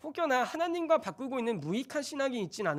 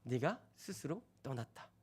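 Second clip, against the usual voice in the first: much softer, low and grave; hushed verdict; 8 semitones lower, 11 dB quieter than usual. Retold or imitated. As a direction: drop to a quiet, low pronouncement. Repeats God's verdict, quietly. (낮고 조용한 선언)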